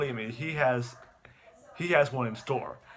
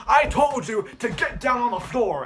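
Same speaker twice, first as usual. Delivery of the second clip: high, loud, drawn-out command; faked threatening shout; 10 semitones higher, 7 dB louder than usual. Boy faking a crime into the phone, loud slow shout. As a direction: play-act yelling orders at a victim. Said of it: "fakes a crime scene".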